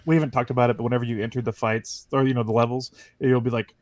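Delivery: ordinary delivery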